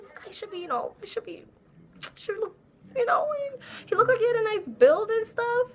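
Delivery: high, airy fantasizing voice; a high, swooning daydream; dreamy imagining. Retold or imitated. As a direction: gush about him in a high voice.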